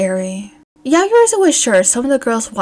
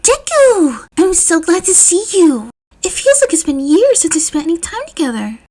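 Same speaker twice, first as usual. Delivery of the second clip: excited greeting; 8 semitones higher, 4 dB louder than usual. A narrator's reading. Uraraka greets Deku eagerly.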